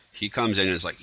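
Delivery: usual speaking voice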